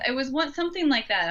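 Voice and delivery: ordinary talk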